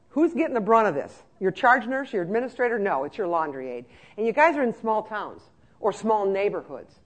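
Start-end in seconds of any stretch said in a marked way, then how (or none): none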